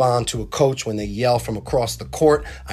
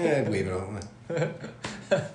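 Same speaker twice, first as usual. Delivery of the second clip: mock lazy wave-off; 8 dB quieter, much slower than usual. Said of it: "it was like".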